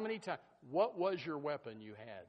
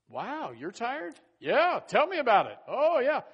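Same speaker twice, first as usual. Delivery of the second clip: higher, louder, hammed-up agreement; mock sympathetic chatter; 6 semitones higher, 12 dB louder, slower than usual. Church friends agree they are tired, hammed-up and loud. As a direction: play the friend who piles on. Acted chat among tired members.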